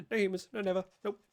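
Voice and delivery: lightly voiced little spirits chanting no; a hint of a fey creature's refusal; imitating the fey. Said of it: "that's actually how they talk".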